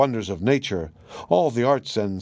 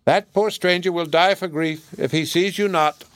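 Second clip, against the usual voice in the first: high, pleading parrot chatter; 6 semitones higher than usual. Acted. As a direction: plead like a talking bird. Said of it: "continued to chatter as he had begun".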